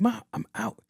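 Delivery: high-pitched protest to mom; imitated exasperated daughter. Daughter reassuring fretting mom, mock exasperated. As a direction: push back in a high daughter voice.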